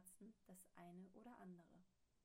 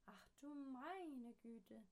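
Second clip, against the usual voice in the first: slow, higher gasp of dismay; shocked townsfolk; 5 semitones higher, 4 dB louder, much slower than usual. Acted. Townsfolk finding the house gone, slow dismayed gasp. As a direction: draw it out, aghast. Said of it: "sagten sie".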